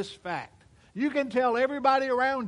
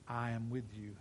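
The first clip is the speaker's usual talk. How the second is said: God's voice, much lower and softer; hushed voice of God; 10 semitones lower, 11 dB quieter than usual. Retold or imitated. The preacher voicing God's gentle promise to him, hushed and low. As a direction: say it low and hushed, like a holy whisper from above. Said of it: "that wonderful voice of God spoke".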